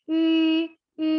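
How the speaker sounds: "I" said with the lips rounded as for U; a rounded ü, between U and I; ü vowel. Shown as a demonstration. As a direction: say "I" through a U-shaped mouth. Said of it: "kalian kayak mau ngomong I, tapi mulutnya U gitu ya".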